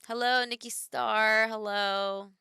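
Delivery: slow drawn-out sing-song hello; a hint of a cartoon greeting; silly greeting voice. Greets chatter in funny voice.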